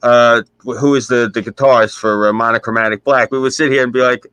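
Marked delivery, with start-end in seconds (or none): none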